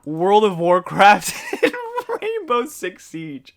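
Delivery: slow, laughing and teasing; laughing mock disbelief. Real, not acted. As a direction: drag it out while laughing. Teasing friend's game choice.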